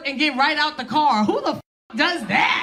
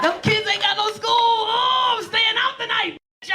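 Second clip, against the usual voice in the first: excited shout; 7 semitones higher than usual. Pretend. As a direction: whoop it up like a freed mom. Yelling joy, no school tomorrow.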